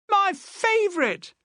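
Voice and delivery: put-on a child's high, drawn-out cry of delight; excited yell. Boy yelling to his dad about breakfast, delighted. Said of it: "Nolan yelled".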